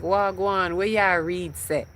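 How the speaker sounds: imitating a Jamaican dad's greeting; Jamaican father. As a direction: greet them in a lilting Jamaican accent. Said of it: "with my Jamaican voice".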